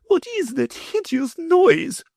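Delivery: high, slow, fierce lady; fussy mother, mock fierce; fierce demand. A narrator's reading. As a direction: round on her husband. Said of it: "she fiercely asked".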